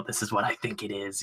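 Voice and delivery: very soft, understated line; quiet. Retold or imitated. Fan imagines Ghost Rider advising Doctor Strange, kind of quiet. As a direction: drop right down, barely voicing it. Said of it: "kind of quiet about it".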